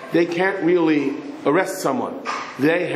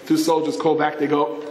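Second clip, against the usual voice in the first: ordinary delivery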